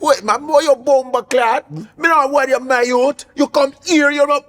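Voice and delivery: high-pitched mock Jamaican accent; Jamaican friends. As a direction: rant in an exaggerated Jamaican patois. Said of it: "how my f-, Jamaican friends be like".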